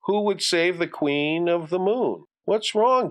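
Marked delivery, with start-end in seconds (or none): 2.4-3.1 lightly voiced mother, pitched up; a hint of motherly concern, high. Mother checks on Johnny in his room, lightly concerned.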